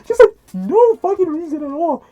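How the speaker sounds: real shock, voice shooting up; stunned disbelief. Real, not acted. Reviewer reacting to the kid's random question, incredulous.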